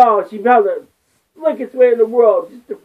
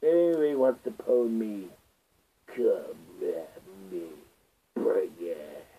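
much lower, soft menacing growl; deep challenge voice; 13 dB quieter than usual. Acted. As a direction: dare them in a deep rumble. Low challenge to viewers.

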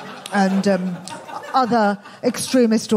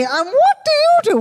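very high, louder plummy royal voice; Queen impression; 4 dB louder than usual. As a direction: ask it like a gracious monarch. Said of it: "when she said to me".

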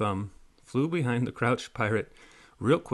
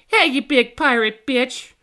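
much higher, loud fairy yell; 11 semitones higher, 6 dB louder than usual. A narrator's reading. Fairy insulting the big pirate, high yell. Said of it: "the fairy yelled".

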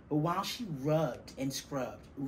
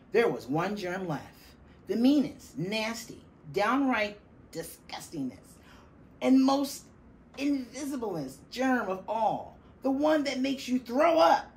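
higher and louder with each word; dramatic build; 8 semitones higher, 8 dB louder than usual. A narrator's reading. Narrator building suspense about the last, worst germ.

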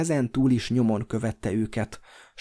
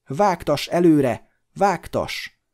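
loud, slow command; shout; 6 semitones higher, 6 dB louder, slower than usual. A narrator's reading. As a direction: call out urgently to the hobbit. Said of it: "kiáltotta Frodónak Glorfindel". (hangos, sürgető kiáltás)

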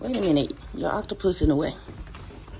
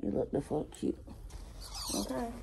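whispering; 4 semitones higher, 14 dB quieter than usual. Real, not acted. Whispered praise to dog.

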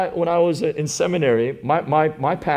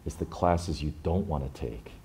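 a hint of a stern teacher, quiet and weighty; stern mentor; 9 semitones lower, 8 dB quieter than usual. Seminary pastor to Sam, quietly stern. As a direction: lower and softer, a grave stern pastor. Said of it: "he got very stern".

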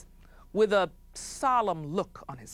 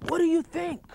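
chief asking; 11 semitones higher than usual. Acted. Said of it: "he would say".

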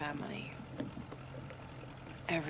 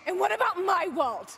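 higher, louder, hurt; raised, wounded demand; angry outburst; 12 semitones higher, 7 dB louder than usual. Acted. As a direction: throw the question back at him in anger. Heated argument with father's killer.

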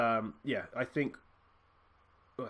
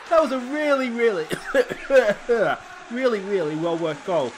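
high, loud, laughing through a cough; breathless excitement; 10 semitones higher, 11 dB louder than usual. Real, not acted. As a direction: still buzzing from the goal, gushing. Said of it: "Oh, I've got too excited".

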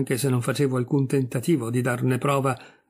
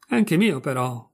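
ordinary delivery, nothing marked

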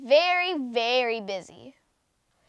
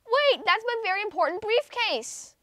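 higher, raised shout; 9 semitones higher than usual. Acted. Mister Brown shouts at the children on the ride. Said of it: "shouted Mister Brown".

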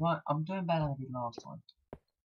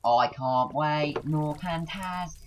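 loud, drawn-out whine, climbing higher; mock impatient whine; 5 semitones higher, 8 dB louder, slower than usual. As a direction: drag the words out, rising in impatience. Whines about waiting for break.

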